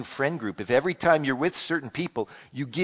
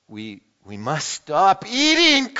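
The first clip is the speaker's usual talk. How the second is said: higher, louder and slow protest; mild mock cry; cried; 5 semitones higher, 6 dB louder, slower than usual. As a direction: plead to stop while still eating. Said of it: "cried Toad as he ate another".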